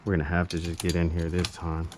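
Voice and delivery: normal talking voice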